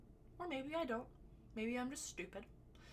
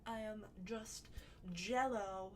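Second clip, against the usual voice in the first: ordinary talk, nothing marked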